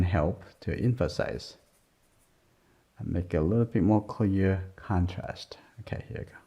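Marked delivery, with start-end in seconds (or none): 5.8-6.4 whispered to herself. Real, not acted. Art teacher whispering as she starts the next stroke. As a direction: say it under your breath as you begin the stroke. Murmurs to herself while drawing.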